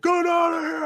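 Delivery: high, loud ejection shout; retold bouncer yell. Security guy grabbing the customer, high mock shout. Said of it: "Grabs me. He's like".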